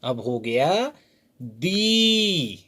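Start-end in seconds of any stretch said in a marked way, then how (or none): 1.4-2.6 दी with a long ई, not a short इ. Shown as a demonstration. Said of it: "दी नहीं पढ़ेंगे, छोटी ई हो जाएगा".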